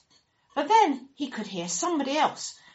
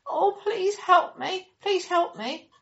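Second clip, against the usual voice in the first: pleading; 10 semitones higher, 5 dB louder than usual. Acted.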